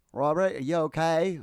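put-on a self-admittedly poor Australian accent; bad Australian accent. Retelling the lady's call in a weak Australian accent. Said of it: "I can't do Australian accents".

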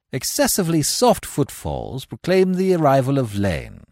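ordinary delivery, nothing marked

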